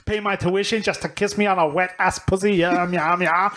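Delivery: put-on shaky-grasp Scottish accent; badly faked Scottish lilt; attempted Scottish. Comedian reading rap lyrics, badly faked Scottish. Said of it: "I'm back to Scottish".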